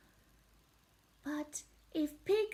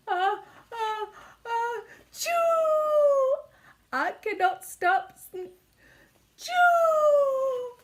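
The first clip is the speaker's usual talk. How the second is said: sneezing while talking; 9 semitones higher, 9 dB louder than usual. Acted. Gerald sneezing mid-sentence.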